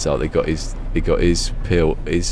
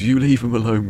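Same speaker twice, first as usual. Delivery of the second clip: raised, louder mock telling-off; scolding; 7 semitones higher, 5 dB louder than usual. Brother scolding Rodney over the balls, raised voice. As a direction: warn him off sharply.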